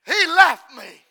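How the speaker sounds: loud, slow, pained plea; loud anguished cry. Acted. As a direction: cry it out from the heart. Preacher voicing prophet's anguish.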